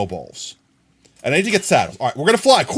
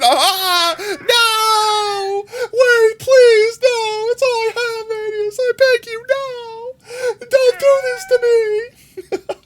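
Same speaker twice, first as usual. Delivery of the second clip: high, mock-sobbing plea, much higher and louder; hammed-up begging; 4 dB louder, much slower than usual. Streamer begging viewer Manius not to report, mock sobbing.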